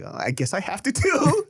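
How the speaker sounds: high, reluctant nerd voice; a little nerd resigned to joining the fight; nerdy younger self. Retold or imitated. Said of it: "my little nerdy ass would be like".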